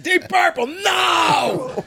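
high shouted wrong answer then a no; playful crowd yell; mock yelled guess. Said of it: "you know, like".

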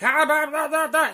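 high, loud mock babble; loud boisterous mockery. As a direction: blurt it out like a noisy kid. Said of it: "loud and boisterous".

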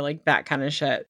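ordinary delivery

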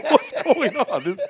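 high laughing what's going on; incredulous laughing squeal; laughing disbelief. Retold or imitated.

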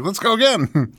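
high eager voice; eager excited. Retold or imitated. Retold eager request, high voice.

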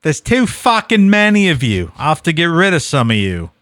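put-on irritated, loud high outburst in a poor Australian accent; bad Australian accent. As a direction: snap it in a badly faked Australian accent. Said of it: "That was not a good Australian accent".

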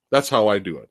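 ordinary delivery

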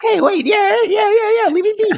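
high comic whimper-plea; mock whine. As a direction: whine like a cartoon kid begging to be left alone. Joking whiny plea, cry heard.

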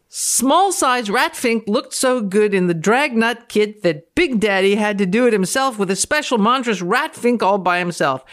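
ordinary talk

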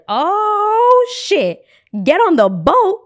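long high "oh", then loud exclamation; hammed-up thrilled disbelief; excited realization. Passenger told to board after all, excitedly amazed.

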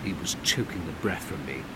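ordinary delivery

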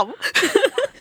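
high giggle. Real, not acted. Giggle breaks out.

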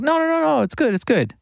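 very high, hurried pleading voice; mocking label people. Label staff begging [name] to keep the song, high pleading voice. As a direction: squeak up in alarm to talk him out of it. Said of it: "And they're like".

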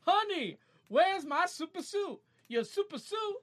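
high, slow hammed-up superhero voice; high mock superhero pleading; Incredibles quote. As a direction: ham up the cartoon superhero. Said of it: "Reminds me of the thing".

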